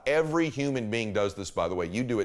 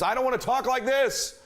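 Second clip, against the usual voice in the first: much higher, over-energetic voice; mismatched high volume; 11 semitones higher than usual. Shown as a demonstration. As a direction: blare it high, overdoing the energy. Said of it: "If they talk at a certain volume".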